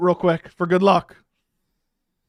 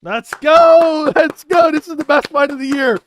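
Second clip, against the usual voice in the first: loud, high "let's go" yell; hyped cheer; 5 semitones higher, 5 dB louder than usual. Real, not acted. Fan hyping up the best fight of the year, excited shouting.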